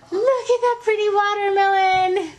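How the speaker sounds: high, slow, delighted; excited gasp. Real, not acted. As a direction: coo over the fruit, thrilled. Gasp at cut melon.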